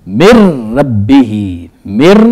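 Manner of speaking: "مر ربہ" with the noon silent, the m running straight into a doubled r; noon dropped, merged into r. Shown as a demonstration. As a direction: say "مر ربہ" slowly, no noon sound at all. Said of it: "اب ہم اس کو یوں پڑھیں گے".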